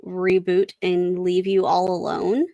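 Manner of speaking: ordinary talk, nothing marked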